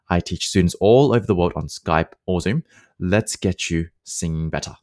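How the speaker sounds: upbeat promo announcement; ad read. Acted. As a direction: pitch your lessons like an ad. Sponsor read.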